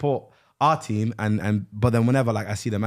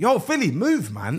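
calling out; 8 semitones higher, slower than usual. Retold or imitated. Shooing teammate off free kick.

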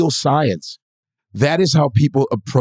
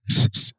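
sharp "tss, tss" through the teeth; imitated punching hisses; 4 dB quieter than usual. Novice copying boxers' punch noises, mock hissing. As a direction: mimic a boxer's hissed breaths. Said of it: "I started going".

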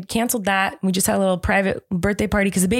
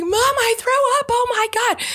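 put-on much higher, childlike panicked voice; scared little boy. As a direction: play the frightened toddler telling mom. Parent voicing scared son.

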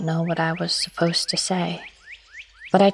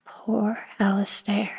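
slow, whispered sorrow; whispered pity; 2 semitones higher, 5 dB quieter, slower than usual. Acted. Helen mourning Alastair in her diary, whispered. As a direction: breathe his name out in a whisper. Diarist overcome recalling the storm.

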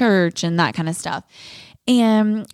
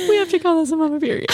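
laughing through the words, higher; 9 semitones higher, faster than usual. Real, not acted. Naming the episode to her cohost, laughing. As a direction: giggle it out, teary. Laughing after emotional moment.

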